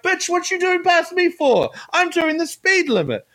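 high-pitched mock indignation; indignant stink-eye. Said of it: "they give you the stink eye".

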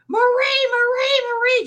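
high loud screaming of a name; retold scream. Friend on the couch calling for her, a high desperate scream. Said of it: "just screaming".

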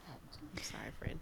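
soft whispered apology. Real, not acted. Apologising to her friend for the topic, whispered. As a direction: whisper it gently to your friend.

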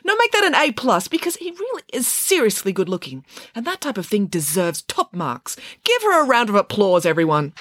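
high-pitched, gushing teacher praise; enthusiastic teacher. Acted. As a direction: award top marks with theatrical delight.

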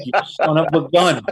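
high, loud laughing outburst; exasperated laughing shout at a friend, high and loud; laughing exasperation. Retold or imitated. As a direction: laugh it out in mock outrage. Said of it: "I'm like".

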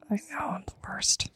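very soft, whispered mock confession; apologetic whisper. Real, not acted. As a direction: own up to it under your breath. Playful apology for ruining it.

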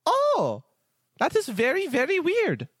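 high, bubbly elf voice; cheery high-pitched elf innkeeper welcome; jolly elf Festo. Acted. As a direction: greet them like the jolliest elf alive.

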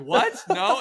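high, loud, disbelieving shout; mock freak-out. Soldiers hearing of a murder attempt, mock shocked shouting. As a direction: blurt it in hammed-up shock. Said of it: "they have a whole freak out".

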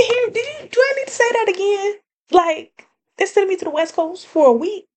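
squealing high and fast with excitement; crazy excited. Real, not acted. To her listeners about the West Coast trip, high excited squeal. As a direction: gush it out, giddy and breathless. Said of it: "I got a little crazy".

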